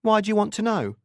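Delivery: guarded, high-pitched challenge. A narrator's reading. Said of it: "she asked them guardedly".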